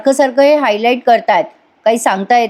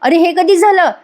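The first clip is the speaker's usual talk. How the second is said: a startled, frightened question, voice jumping higher; scared; 7 semitones higher, slower than usual. A narrator's reading. Arjun hears of Sakshi's arrest, frightened. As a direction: sound suddenly alarmed. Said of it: "Arjun घाबरतो आणि म्हणतो".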